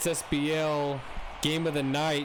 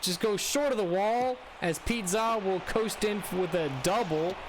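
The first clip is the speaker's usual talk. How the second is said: live play-by-play; 7 semitones higher than usual. Acted. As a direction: call the hit like a broadcaster.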